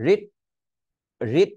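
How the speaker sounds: "রিট" cut short on a held T; "রিট" with no puff of T; unreleased stop T. Shown as a demonstration. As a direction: stop dead at the end of "রিট". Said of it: "মানে আমি টি উচ্চারণ করতেছি না".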